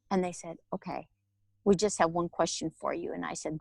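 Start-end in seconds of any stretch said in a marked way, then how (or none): 0.7-3.1 soft, lower voice from within; calm inner voice. Retold or imitated. Inner voice replying to her surrender, quietly. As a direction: answer gently and low, as the inner voice. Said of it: "I heard the voice again".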